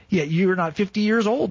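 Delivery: a high, mocking retort; scoffing disbelief. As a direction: scoff at the claim.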